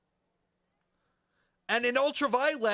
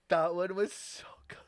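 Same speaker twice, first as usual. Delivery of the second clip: laughing at own joke; 3 semitones lower than usual. Real, not acted.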